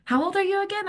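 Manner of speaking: high, teasing question; teasing sponsor. Retold or imitated. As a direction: needle her with a question. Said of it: "He says to me".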